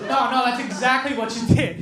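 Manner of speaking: ordinary delivery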